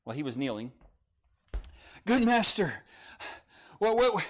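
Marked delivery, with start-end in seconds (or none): 2.0-4.2 higher, broken gasps between words; out of breath. Acted. Kneeling man asking Jesus, panting and breathless. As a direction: stumble over the words, winded from running. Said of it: "wasn't as out of breath as I am".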